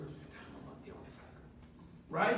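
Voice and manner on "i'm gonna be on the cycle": soft, fast, voiceless whisper; wife whispering a warning to her husband. Retold or imitated. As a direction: lean in and whisper the secret fast. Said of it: "my wife will come whisper".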